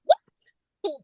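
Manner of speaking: sudden high-pitched shocked "What?"; squeaky startled question. Retold or imitated. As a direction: jolt up into disbelief. Shocked reaction to book news.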